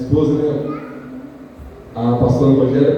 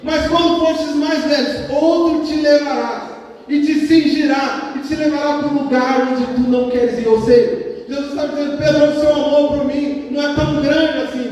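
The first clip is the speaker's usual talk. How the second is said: high, sustained sermon voice; impassioned prophetic preaching; 10 semitones higher than usual. Real, not acted. Preacher retelling Jesus's prophecy to Peter, impassioned preaching. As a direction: proclaim it from the pulpit at raised pitch.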